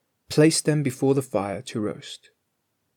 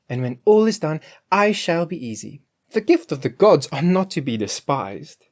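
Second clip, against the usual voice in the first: Grethel's voice; 5 semitones higher, 5 dB louder than usual. A narrator's reading.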